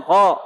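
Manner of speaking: "Kok" loud from the back of the tongue; firm "Kok" with lips left unrounded; unpouted qaf, emphatic. Shown as a demonstration. Teacher repeats correct qaf, loud unpouted "Kok". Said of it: "jangan monyong".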